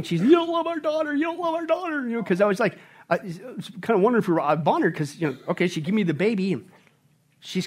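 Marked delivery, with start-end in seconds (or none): 0.2-2.1 high, mock-tearful wail of an upset wife; imitated crying. Husband retelling his wife's crying complaint, mock-tearful. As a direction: wail it as an exaggerated tearful impression. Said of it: "she came in crying".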